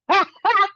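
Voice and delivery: high loud burst of laughter; sharp laugh. Real, not acted. Laughing after telling coworkers we're working, high and loud. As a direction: laugh at your own comeback.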